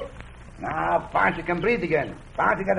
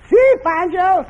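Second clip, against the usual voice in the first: much higher, louder put-on voice; 6 dB louder, slower than usual. Pancho tries the high voice Cisco asked for, much higher. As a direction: squeak it up high as a different man. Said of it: "A high voice".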